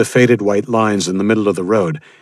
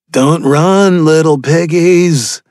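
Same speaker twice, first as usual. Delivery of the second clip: loud, higher, slow mocking call; taunting call; 10 semitones higher, 7 dB louder, much slower than usual. Cannibal calls after the fleeing boy, loud and mocking. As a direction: sing out the taunt at prey. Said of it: "one of them called".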